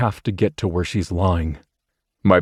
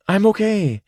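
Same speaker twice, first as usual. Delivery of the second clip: put-on higher girl's voice, a touch out of breath; breathless Rachel. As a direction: reassure him between breaths. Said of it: "Her voice is breathless".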